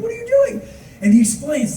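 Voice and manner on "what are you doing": very high-pitched incredulous question; baffled. Retold or imitated. Tour staff question an early bus, high and baffled. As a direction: squeak it in mock disbelief.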